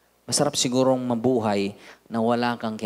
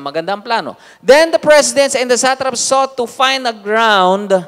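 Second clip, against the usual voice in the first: scripture reading; 10 semitones higher, 11 dB louder than usual. Acted. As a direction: proclaim the verses to the church. Continues reading scripture aloud. (malakas at mabagal na pagbasa ng Bibliya)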